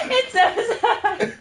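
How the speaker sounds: can't stop laughing through tears; hysterical laugh-cry. Real, not acted. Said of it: "hysterically crying right now".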